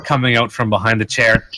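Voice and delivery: normal talking voice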